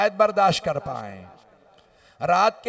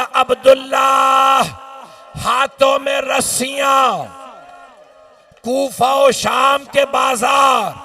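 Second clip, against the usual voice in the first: loud, slow, pleading sermon cry; shouted lament; 4 semitones higher, 7 dB louder than usual. Acted. Preacher's final lament to mourners, loud slow cry. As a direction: hammer each grief out slowly.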